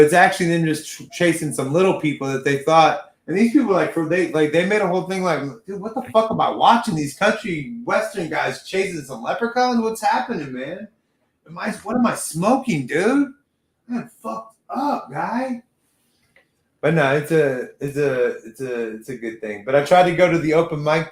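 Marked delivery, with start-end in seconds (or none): 5.6-15.6 higher, incredulous stoner questioning; mock bewilderment. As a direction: lightly act out baffled disbelief at the TV. Acting out his own stoned bewilderment.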